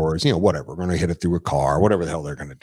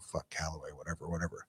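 much softer, tossed-off grumble; muttering under breath; 3 semitones lower, 18 dB quieter, faster than usual. Retold or imitated. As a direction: mutter it, dismissive and low-key. Muttered dismissive thought.

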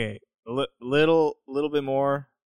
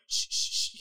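soft repeated shush; hushed shushing; 8 dB quieter, faster than usual. Retold or imitated. Partygoers telling each other to be quiet, soft shushing. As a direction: imitate a crowd hushing everyone. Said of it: "Be quiet".

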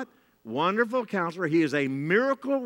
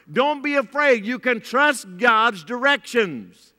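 louder, rousing preacher voice; preaching at full force; 4 semitones higher, 4 dB louder than usual. Real, not acted. Pastor urging the church to obey God, rousing. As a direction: drive the congregation past their fear.

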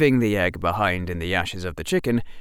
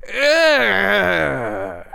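loud high groan of disgust; an exasperated ugh; frustrated groan; 12 semitones higher, 6 dB louder than usual. Acted. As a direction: give up in despair.

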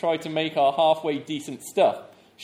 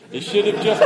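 laughing; 4 semitones higher, 8 dB louder than usual. Real, not acted. Laughing at the blown-up Colosseum.

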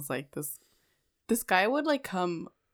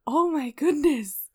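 high, slow, stretched amazement; mock shock; 10 semitones higher, 6 dB louder, slower than usual. Woman retelling being proposed to, mock shocked. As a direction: draw it out high, as if stunned.

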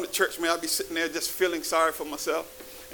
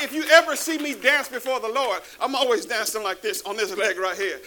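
ordinary talk, nothing marked

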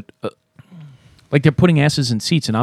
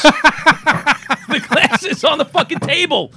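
high laughing, slow words; much slower than usual. Real, not acted. Laughs at own drunken mistake.

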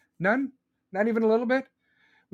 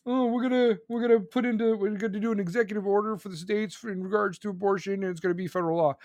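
mocking politician; 3 semitones higher than usual. Mocking politicians' promises in quote.

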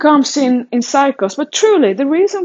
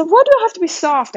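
indignant retort; 8 semitones higher, faster than usual. Retold or imitated. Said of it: "I got so mad".

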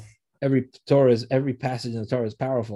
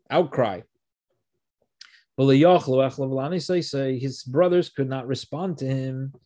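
ordinary talk

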